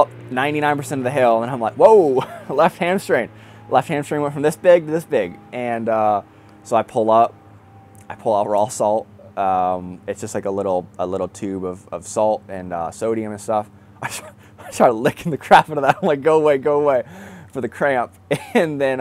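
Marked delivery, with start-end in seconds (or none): 1.7-3.3 sudden loud, high yelp at a cramp; laughing alarm at a sudden cramp; startled cry. Retold or imitated. Cramp hits on the hill. 16.2-17.0 loud, high pleading at the cramp; yelling at cramp. Retold or imitated. Shouting at his cramp.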